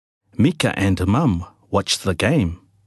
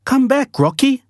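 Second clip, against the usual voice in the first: high, louder call across the field; 3 dB louder than usual. A narrator's reading. Mika calls Rocky off the field, high and loud. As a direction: call the dog back from far away. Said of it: "shouted Mika".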